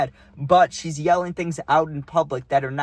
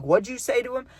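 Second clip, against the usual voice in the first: heated challenge; 8 semitones higher than usual. Retold or imitated. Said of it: "like, heated".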